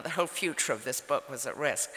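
ordinary talk, nothing marked

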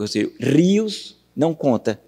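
usual speaking voice

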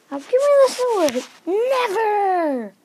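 dramatic mock battle cry; pretend defiant standoff. Toy fighters arguing over the sword, high defiant exclaiming.

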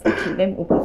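ordinary talk

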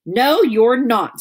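loud and slow, flatly refusing; big sister flatly refusing the fake ghost, loud and slow; firm loud no. Nancy refusing, unimpressed.

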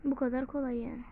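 ordinary talk, nothing marked

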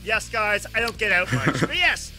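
ordinary talk

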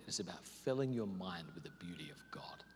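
soft hushed whisper. Shown as a demonstration. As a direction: let it fall to a breathy whisper. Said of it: "describing meditation".

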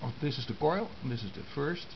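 ordinary delivery

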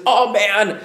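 high, loud, gushing gratitude; emphatic thankfulness. Acted. Preacher voicing Paul to the church, loudly thankful. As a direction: pour out thanks at full voice. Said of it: "I am thankful".

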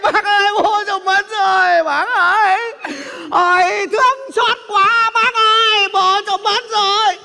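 high, drawn-out mock wailing; hammed-up grief. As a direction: wail theatrically like a fake mourner. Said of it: "Bác có biết là cháu buồn như nào không".

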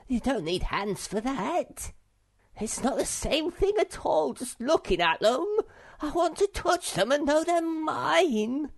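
high, loud, miserable whine; Sniff. A narrator's reading. Sniff protests to Snufkin about the garnets, whining miserably. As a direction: build to a squeaky wail of protest. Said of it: "said Sniff miserably".

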